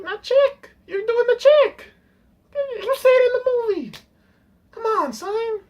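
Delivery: exasperated ranting. Real, not acted. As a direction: call out an obvious blunder in disbelief. Exasperated rant at the film's mistake.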